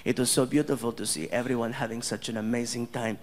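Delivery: low, measured, dignified thanks; gracious prince. Retold or imitated. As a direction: speak like a composed, gracious royal. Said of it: "He's like".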